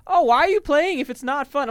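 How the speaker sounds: high, loud imitation of a complaining girlfriend; mimicked nagging girlfriend. Gamer retelling his girlfriend's complaint, high loud mimicry. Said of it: "she's like".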